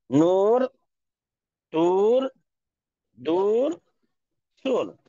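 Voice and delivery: नूर, तूर, दूर, सूर said with a long uu; each word read out slowly and clearly; clear drill reading. Shown as a demonstration. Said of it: "सब के सब जो है मजहूल नहीं, मारूफ पढ़े जाएंगे".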